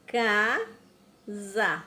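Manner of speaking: "Casa" said slowly with the S sounded as a clear Z; "Casa" with the S buzzed like a Z, taught slowly; showing S as Z. Shown as a demonstration. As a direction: lean on the buzzing Z in "Casa". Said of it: "Olha o sonzinho do S".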